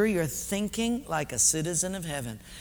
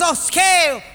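put-on raised, local-accented quiz boss; personnel guy, insistent; 8 semitones higher, 6 dB louder, slower than usual. Personnel guy puts the next question, loud and insistent. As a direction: pose it loud and high, like a pidgin quizmaster.

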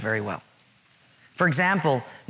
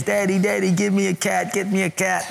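put-on higher-pitched child pestering dad; begging kids; 4 semitones higher than usual. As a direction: imitate a kid pleading insistently. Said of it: "It's like my kids".

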